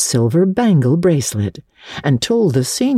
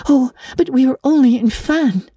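put-on high, earnest girl's voice pleading a case; an orphan girl defending her friend; eager little girl Anne; 12 semitones higher than usual. A narrator's reading. Anne pleads with Miss Barry for Diana, high and earnest. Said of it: "persisted Anne".

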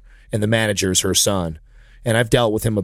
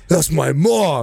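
slow, drawn-out offended retort, higher; hammed-up outrage, pitched up; mock indignation; 4 semitones higher, much slower than usual. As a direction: imitate an affronted son, stretching it out. Retells offended son's retort.

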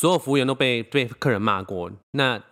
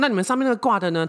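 mock demanding mom; 10 semitones higher, faster than usual. Mother demanding display item.

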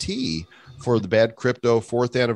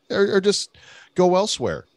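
ordinary talk, nothing marked

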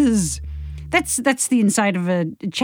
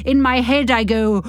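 usual speaking voice

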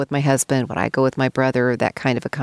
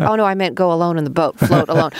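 imitated hasty correction, pitched up a little; mock-flustered clarification; backtracking husband; 3 semitones higher than usual. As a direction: backpedal as the misunderstood husband. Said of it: "my husband's backtracking".

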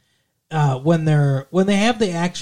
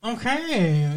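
lightly tight-lipped, drawn-out and higher; Jennifer Aniston impression; 6 semitones higher, 4 dB quieter than usual. Demonstrating her delivery for co-host, lightly tight-lipped and higher. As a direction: mimic a tight-lipped sitcom actress. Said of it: "she's all tight-lipped".

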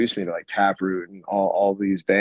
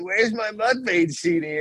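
put-on high-pitched childish protest; retold indignant kid; 10 semitones higher than usual. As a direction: imitate a kid demanding a missing thing. Retold kid confronting his mom.

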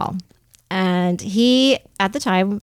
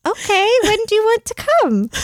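mock bewildered; 12 semitones higher than usual. Acting the bewildered showroom on the phone.

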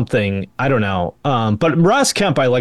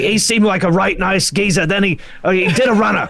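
high, exaggerated Cockney geezer voice; mock Cockney Danny Dyer; 6 semitones higher than usual. Friend imitating Danny Dyer's scared Cockney narration, hammed-up Cockney accent. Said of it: "and then it's like".

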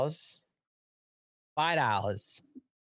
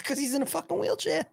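punchline blurt; 11 semitones higher, faster than usual. Retold or imitated. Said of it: "I says".

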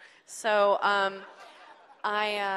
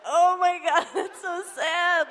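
mock pity; 10 semitones higher, 10 dB louder, slower than usual. Crew's pitying reaction to star guy.

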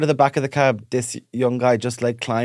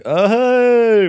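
high, loud mock-surprised oh hey; surprised greeting; 10 semitones higher, 7 dB louder than usual. Listener greets podcast fan with loud surprise. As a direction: act caught off guard, brightly. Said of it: "and I was like".

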